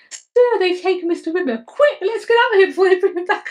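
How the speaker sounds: high, urgent mock alarm; quoting Kat's panic. Fan quoting Kat fleeing the horror ship, high mock panic. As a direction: squeak it out like a panicked crew member. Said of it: "Kat gets this wonderful gag".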